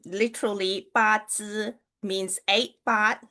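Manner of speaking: ordinary talk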